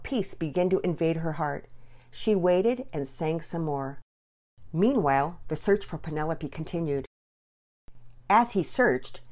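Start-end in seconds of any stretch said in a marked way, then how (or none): none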